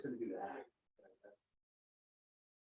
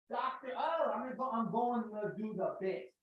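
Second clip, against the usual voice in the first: usual speaking voice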